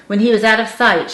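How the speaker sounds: usual speaking voice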